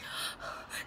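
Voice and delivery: quiet sharp intake of breath; soft shocked gasp. Retold or imitated. Watching story times, hushed shocked gasp. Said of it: "I'm just like".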